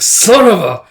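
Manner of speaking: loud, high cut-off curse; cursing outburst. Retold or imitated. Guts curses after being dumped overboard, in an angry outburst. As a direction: exclaim it as if just dumped down.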